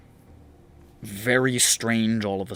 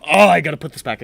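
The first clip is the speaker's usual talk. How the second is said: acted urgent compulsion; 3 semitones higher, 7 dB louder, faster than usual. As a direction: blurt it out as if suddenly compelled. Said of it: "You're like".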